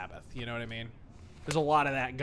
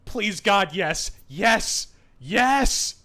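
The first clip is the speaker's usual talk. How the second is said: excited pleading; 4 semitones higher, 7 dB louder, much slower than usual. Real, not acted. Hoping to go to college.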